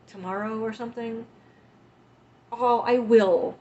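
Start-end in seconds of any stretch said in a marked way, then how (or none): 2.5-3.6 slow, much louder vow; a drawn-out, loud "I will"; emphatic promise. Real, not acted. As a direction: lean into the promise. Promising viewers another stream.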